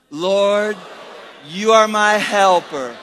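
loud, slow, drawn-out confession; preaching declaration. Real, not acted. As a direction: proclaim it slowly and forcefully to the congregation. Repeats confession one more time.

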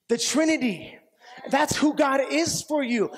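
rising, fervent preaching shouts. Acted.

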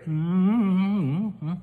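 normal talking voice

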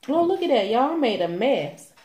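high, startled "oh look"; surprised. Real, not acted. Spills food on camera.

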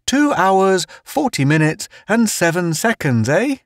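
high-pitched jovial character voice; chuckling teaser. A narrator's reading. Town ribbing the outposter about counting time, chuckling high voice. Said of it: "Town chuckled".

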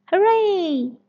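high, loud happy cheer; children cheering. Acted. Children cheering about the mountain trip, high and loud. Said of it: "cheer all the children".

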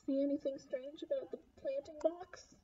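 ordinary delivery, nothing marked

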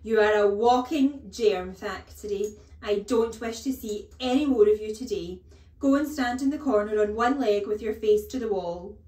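very loud disgust turning to orders; furious headmistress sounding off; bellowed tirade. A narrator's reading. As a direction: banish the boy at full voice. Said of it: "the Trunchbull bellowed".

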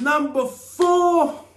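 high, slow drawn-out announcement; game show announcer. Acted. Reading the next question, he announces it like a host. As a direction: stretch it out like a quiz host.

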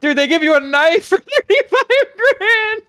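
high-pitched, laughing incredulity; laughing disbelief. Real, not acted. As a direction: crack up while exclaiming, pitch shooting up. Laughing disbelief at knife price in game.

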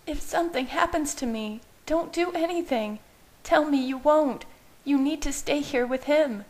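high, frantic pleading; begging him not to follow; panicked Bella. Acted.

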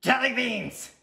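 muffled yell from the back of class; muffled outburst. A narrator's reading. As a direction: shout it as if through a muffle. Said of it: "said a muffled voice".

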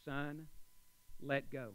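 low, quiet divine command; calm, low word from above; God's voice. Acted. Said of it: "And the voice says".

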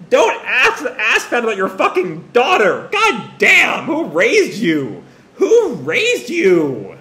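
loud, high scolding outburst; mock outrage. Reader scolding the mother for her question, loud appalled rant. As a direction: explode in indignation, then stretch the final questions slow.

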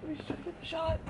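high quiet whiny voice; high whine. Real, not acted. Waiting for the photo, high quiet whine. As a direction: whine it softly. Repeat before the whisper.

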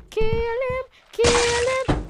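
high excited urging; egging on the zombies, pitch up; urging kill. Real, not acted. Gamer eggs on his zombies, high and excited. Yelling orders at game zombies.

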